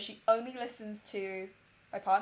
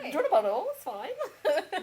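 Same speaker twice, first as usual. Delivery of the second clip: high-pitched, laughing eager offer; mock gushing; 8 semitones higher than usual. Offering the flattering guest drinks, mock gushing.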